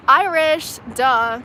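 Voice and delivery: a loud, sure answer tossed straight back; emphatic duh. Real, not acted. Answering the chat's accent question, emphatic and loud.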